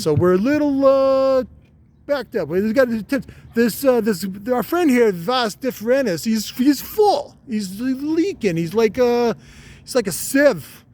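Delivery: a tiny wiseguy pleading his case up high; Vinny Barbarino testicle. Acted. A high-voiced testicle begging the boss to relieve the pressure. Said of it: "he's like Vinny Barbarino or something".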